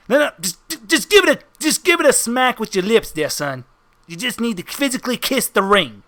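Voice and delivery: lightly voiced wiseguy, pressing the point; insistent mobster henchman. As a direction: coax the newcomer like a mob heavy. Henchman demands ring be kissed.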